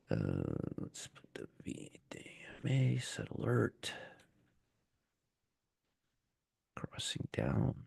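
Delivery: very soft, half-whispered self-talk; muttering to self. Real, not acted. Trader talking himself through setting an alert, whispered mutter. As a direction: murmur it under your breath while busy.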